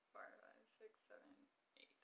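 counting stitches under the breath; soft murmured count; whispered counting. Real, not acted. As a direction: whisper the numbers to yourself.

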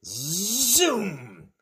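long buzzing zoom, up and down; imitated whoosh, stretched out; zooming sound. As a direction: swoop the word like a flying toy. Toy flying through the air.

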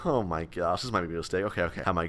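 barely-there nervous murmur; hushed dread. Real, not acted. Gamer sneaking downstairs, whispered dread.

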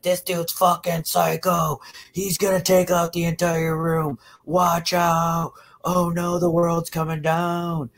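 high-pitched hammed-up alarm; mock panic. Mimicking women reacting to small guys yelling, mock panic. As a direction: wail a mock warning in a high voice, words drawn out. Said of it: "you guys are like".

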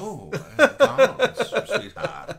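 high, slow, laughing put-on voice; mock him through laughter; laughing impression of Jamie. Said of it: "I don't say sweetheart".

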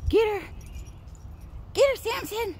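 high-pitched egging on of the dog; excited urging. Real, not acted. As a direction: cheer the dog on.

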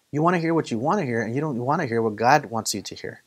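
ordinary delivery

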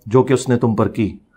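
slow, low, measured reading; scripture translation. Real, not acted. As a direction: render the verse in low phrases with long pauses. Renders the verse's translation solemnly. (आयत का धीमा, ठहरा हुआ तर्जमा)

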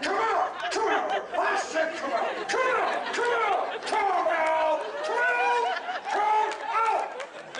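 high, loud, repeated command; commanding shout. Retold or imitated. Preacher commanding the demon to leave, shouting. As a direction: yell it at the spirit. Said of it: "So I'm like".

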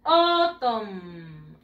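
"Autumn" drawn out as OOH-tommm, falling; pronunciation model. Shown as a demonstration. Teacher has pupils repeat after her, "Autumn" as slow OH-tom. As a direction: stretch "Autumn" from a long o down to a held m. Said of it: "Una vez más".

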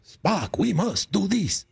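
mock Shatner, dramatic pauses; imitating Shatner. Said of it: "he imitated his acting".

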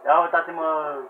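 usual speaking voice

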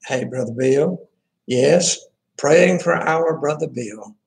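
normal talking voice

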